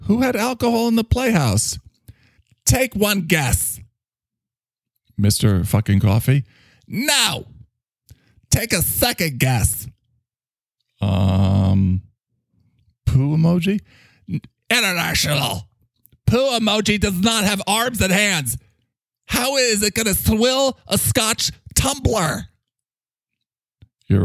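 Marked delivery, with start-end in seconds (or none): 0.1-3.8 higher, alarmed herald of doom; high, dramatic character voice. Acted. Character voice continues. 6.9-9.9 higher, alarmed herald of doom; high, dramatic character voice. Acted. Character voice continues. 13.0-22.4 higher, alarmed herald of doom; high, dramatic character voice. Acted. Character voice continues.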